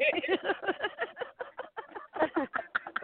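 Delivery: high snorting giggle fit; real snort-laugh bursting out. Real, not acted. Co-host cracking up mid-show with a snorting laugh. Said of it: "you and your snorting".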